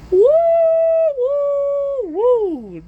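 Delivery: high, sustained woo sounds; long whoops. Real, not acted. Storyteller ending the tale, high long whooping.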